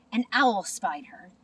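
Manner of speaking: ordinary delivery, nothing marked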